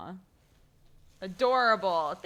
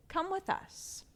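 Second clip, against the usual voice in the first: turtle's invitation; 12 semitones higher, 4 dB quieter, slower than usual. Acted. Turtle voiced in story.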